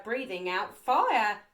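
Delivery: usual speaking voice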